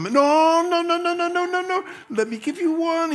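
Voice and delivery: put-on emphatic high Cuban-accented no no no. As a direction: brush off the refusal in a Cuban accent. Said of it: "a man with a Cuban accent comes over".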